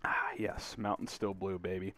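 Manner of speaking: low, hushed satisfaction; near-whispered, contented aside after a drink; savoring a sip. Real, not acted. Host savors a sip of Mountain Still Blue, hushed satisfaction.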